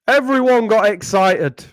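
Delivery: normal talking voice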